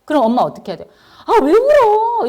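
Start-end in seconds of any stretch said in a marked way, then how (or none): 1.3-2.3 high, loud and slow scolding; exasperated mom. Retold or imitated. Mom scolding her crying child, loud high and slow. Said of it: "이러면서".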